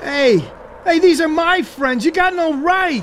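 angry protest. Acted. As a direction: yell back at him, defending friends. Teen defies older brother.

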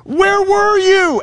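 high, slow, aching question; accusing Martha. Retold or imitated. Martha accuses Jesus of not coming, high and slow. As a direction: press the accusation. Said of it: "That, that's an accusation".

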